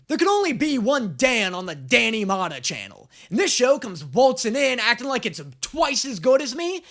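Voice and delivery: high, loud hammed-up outrage; mock indignation. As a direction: play at being offended by a rival. Mock rivalry with the show's title.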